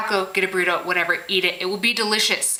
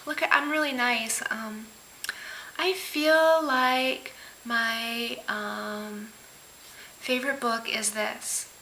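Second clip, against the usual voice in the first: mock passive-aggressive; 5 dB quieter, much slower than usual. Imitating people who act really nice, passive-aggressive. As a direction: stretch it out with hesitant, sugary politeness. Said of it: "such a passive aggressive, like".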